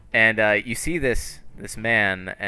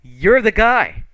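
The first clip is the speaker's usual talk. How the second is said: excited recognition; 10 semitones higher, 8 dB louder than usual. Acted. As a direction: exclaim it like spotting someone famous. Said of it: "and they go".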